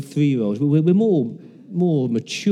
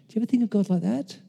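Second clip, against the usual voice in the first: ordinary talk, nothing marked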